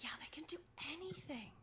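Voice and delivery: high, breath-light whisper; confiding whisper. Acted. Merchant confides to her customer about wizards, whispering. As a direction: whisper it like juicy gossip.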